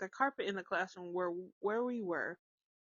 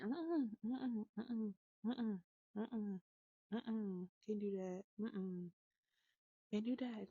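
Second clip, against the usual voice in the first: soft stern no-no; 7 dB quieter than usual. Retold or imitated. Mom firmly hushing child.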